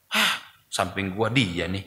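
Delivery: low, soft grumble under the breath; grumbling aside. Retold or imitated. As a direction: mutter a petty complaint at prayer. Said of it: "gitu deh". (gerutu pelan dan rendah)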